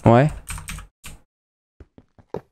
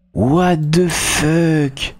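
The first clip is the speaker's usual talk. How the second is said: drawn-out disbelief; 3 semitones higher, much slower than usual. Real, not acted. Slow stunned disbelief.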